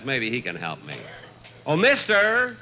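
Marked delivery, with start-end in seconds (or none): 1.7-2.5 calling out. Acted. Calling out to distant instructor.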